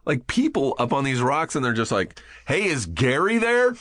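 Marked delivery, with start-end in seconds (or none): 2.5-3.8 high-pitched imitation of a searcher hailing strangers; calling down. Sheriff's searchers hailing a group on rocks, calling down. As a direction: call out the question as if from a helicopter.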